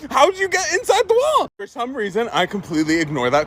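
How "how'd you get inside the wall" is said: much higher, laughing imitation of confusion; mock confused mom. Mom baffled that her son is inside the wall. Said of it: "she's like".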